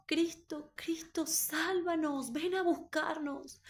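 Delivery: high, slow, pleading cry to Christ; tearful plea. Retold or imitated. Congregation weeping and begging Christ to come, high slow plea. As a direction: beg for rescue, voice up high and drawn out. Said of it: "llorábamos y decíamos".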